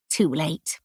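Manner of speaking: ordinary delivery, nothing marked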